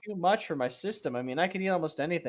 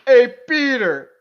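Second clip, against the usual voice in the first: loud, drawn-out, higher-pitched hail; hammed-up call; 7 semitones higher, 12 dB louder, slower than usual. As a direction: call it out slow and big. Hammed-up reading, reader breaking up.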